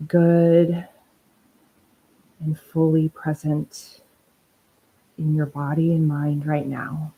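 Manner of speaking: unhurried, even-toned meditation leading; guided meditation. Acted. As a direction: ease listeners into stillness, phrase by phrase.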